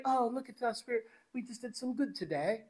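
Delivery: put-on soft, self-satisfied English accent; hushed Tiny Tim-style English accent. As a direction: put on a quiet mock English voice. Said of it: "I don't know why the English accent came out".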